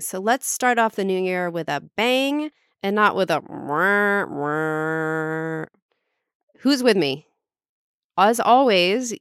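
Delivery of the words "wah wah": mock sad-trombone wah-wah; deflated "wah wah". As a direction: drone a slow letdown "wah, wah". Imitated sad trombone against a bang.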